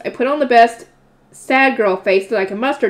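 plain reading voice

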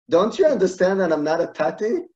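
imitating a man at his wits' end; retold exasperated outburst.

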